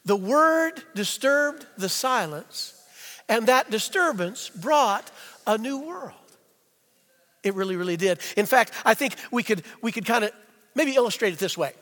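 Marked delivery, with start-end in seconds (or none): 0.0-6.1 slow, measured group recitation; deliberate, word by word, evenly paced; leading a recitation. Shown as a demonstration. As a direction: say it slowly so everyone can join in. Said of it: "Say it with me".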